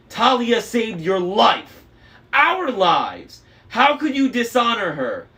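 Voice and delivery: loud, high, slow outraged accusation; furious. A narrator's reading. Annabeth accusing Luke of dishonoring Talia, furious. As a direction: hurl it at him.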